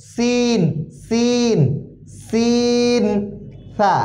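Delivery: সিন and সা said very thin and light; very thin letter sound. Shown as a demonstration. Teacher shows students the thin letter sound. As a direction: keep সা thin and airy, nothing heavy. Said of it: "একদম পাতলা".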